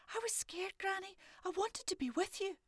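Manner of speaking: put-on high child's voice, a bit sheepish; a small girl owning up; little girl Morag. Morag explaining to Granny why she followed, high child voice. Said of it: "Morag explained".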